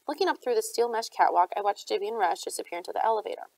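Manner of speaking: ordinary delivery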